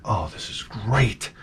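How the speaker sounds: low, slow, soft excited whisper; hushed delight. Retold or imitated. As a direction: hush it like a secret plan. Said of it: "So I'm like".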